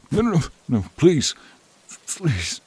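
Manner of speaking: high frantic plea; panicked pleading. Acted. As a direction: cry out for them not to leave. Creature begging not to die.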